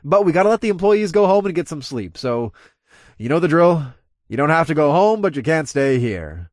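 loud, high, cajoling crowd address; announcing. Acted. Club owner sending drinkers home, announcing loudly.

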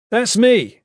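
high, loud claim. A narrator's reading. Goss claims to be Kip before a stranger, loudly. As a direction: speak up boldly for all to hear. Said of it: "Goss said loudly".

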